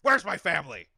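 mock action-hero shout; loud, hammed-up desperate demand; Harrison Ford. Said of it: "Harrison Ford also protecting his family".

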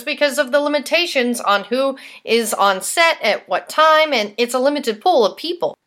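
lively, presentational host delivery; punchy scripted read with emphatic key words; scripted podcast news read. Real, not acted. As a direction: read it out like a soap news segment.

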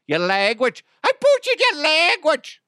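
high, loud, hammed-up Irish; mock Irish accent. Shown as a demonstration. Comic apologising to Cindy from Ireland in a mock Irish accent. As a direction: squawk it in a terrible Irish accent.

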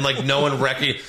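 ordinary talk, nothing marked

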